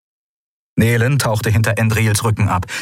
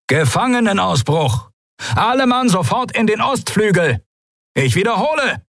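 urgent alarm over loudspeakers; over a PA system; 12 semitones higher than usual. A narrator's reading. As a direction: bark the alarm through speakers.

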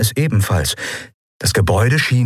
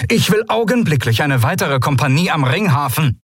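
called out; 8 semitones higher than usual. A narrator's reading. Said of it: "rief Monaro".

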